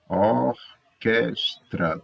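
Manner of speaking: ORQUESTRA very slow, or-ques-tra; syllable by syllable. Shown as a demonstration. Teacher counting syllables in Orquestra, syllable by syllable. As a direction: say Orquestra slowly in three separate beats. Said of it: "Fale as palavras a seguir em voz alta".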